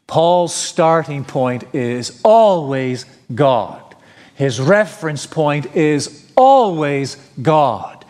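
"always" leaned on hard, said slowly; slow, stress on always. Acted. Preacher drilling the congregation, always stressed slowly. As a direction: drag it out and land on "always". Preacher hammering key point.

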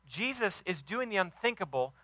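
ordinary talk, nothing marked

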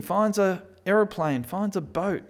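usual speaking voice

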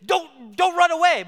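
high, loud pleading yell; father scolding his runaway toddler; yelling at her. Retold or imitated. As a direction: cry out to her, half scolding, half begging. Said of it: "I wanted to yell at her".

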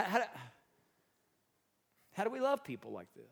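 usual speaking voice

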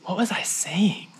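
breathy half-voiced whisper to himself; whispered aside. Real, not acted. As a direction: drop to a whisper, lost mid-thought. Lost track, aside to self.

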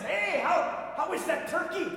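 high, loud mocking coworker voice; loud teasing office prank; coworkers' taunt. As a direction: tease him like a smug coworker.

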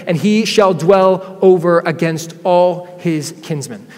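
loud, rising proclamation of the verse; loud scripture proclamation; scripture reading. Acted. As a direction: proclaim the promise with building force.

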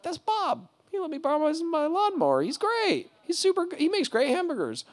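very high, excited praise of a neighbour; high-pitched delighted gushing. Retold or imitated. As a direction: gush about a friend. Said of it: "you're like".

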